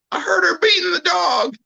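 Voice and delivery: high, tearful hammed-up tattling; mock upset neighbor. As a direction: whine it like a nosy neighbor. Mock neighbor reporting abuse.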